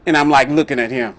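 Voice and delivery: normal talking voice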